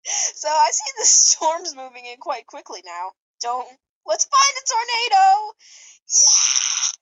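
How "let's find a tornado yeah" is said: high, loud, eager cheer; excited cheer. Acted. Sonny cheering to chase a tornado, excited cheer. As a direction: whoop it like a thrilled kid. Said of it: "Sonny cheered".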